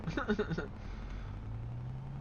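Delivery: breathy laugh. Real, not acted. Laughs at his own offer.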